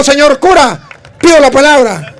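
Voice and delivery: loud, high, eager call for the floor; eager request. Retold or imitated. Child asks the bishop's leave to speak, loudly and eagerly. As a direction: claim the floor boldly.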